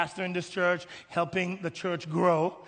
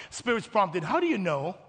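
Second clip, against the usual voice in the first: ordinary talk, nothing marked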